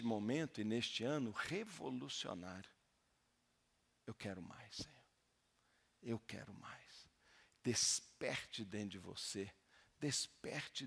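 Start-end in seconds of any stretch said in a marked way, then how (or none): 4.1-7.1 quiet prayer. Shown as a demonstration. Voices a quiet prayer to God.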